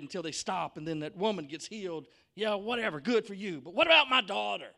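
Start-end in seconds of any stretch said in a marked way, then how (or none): 2.3-4.7 higher, louder impatient protest; indignant father. Acted. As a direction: protest loudly, climbing to a peak. Anxious father protesting the delay.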